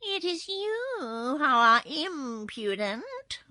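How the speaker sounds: high, loud, slow cat voice; insolent kitten. A narrator's reading. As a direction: retort haughtily, drawing it out. Kitten retorts to the girl.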